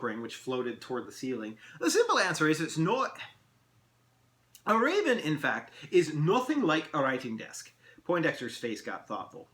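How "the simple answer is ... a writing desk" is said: high, animated elf pronouncement; lively, lilting elf lecturer; amused elf. Acted. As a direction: explain with playful pomp. Elf answers the riddle.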